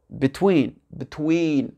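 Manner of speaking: "between" with short "bi" and long stressed "tween"; correct English pronunciation. Shown as a demonstration. Teacher modelling the word for French learners, stressed second syllable. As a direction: stretch the second syllable of "between", first one barely there. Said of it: "ça va se prononcer between".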